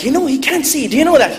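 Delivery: high-pitched questioning auntie voice; pleading aunt. Acted. Aunts pleading with grandmother.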